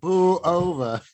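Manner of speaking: mimicked challenge. Co-host echoing the old driver's challenge, mimicked. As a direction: drag out the punchline through a laugh. Co-host echoes the joke's punchline.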